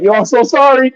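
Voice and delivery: high, loud squeaky sorry; mock apology. Wrestler apologizes to opponent before the chair shot, high mock sorry.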